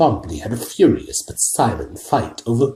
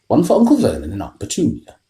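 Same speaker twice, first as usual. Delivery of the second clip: usual speaking voice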